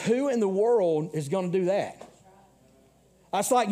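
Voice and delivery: ordinary talk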